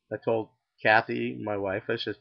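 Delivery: usual speaking voice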